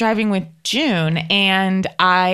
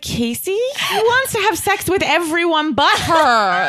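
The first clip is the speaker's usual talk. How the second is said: higher, incredulous friend correcting her; imitating June; 8 semitones higher than usual. Friend June correcting her misunderstanding, incredulous. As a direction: play the friend setting her straight.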